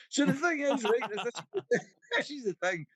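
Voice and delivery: ordinary delivery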